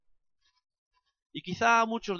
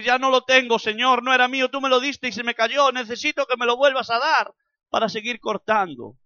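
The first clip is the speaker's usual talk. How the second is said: pleading prayer; 8 semitones higher, 11 dB louder than usual. Acted. Voiced prayer of confession.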